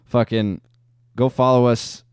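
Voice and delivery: ordinary delivery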